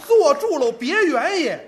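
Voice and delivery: high, loud holler; shouted order. Acted. Old spectator tells everyone to stay seated, loud eager shout. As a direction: call out urgently over the crowd.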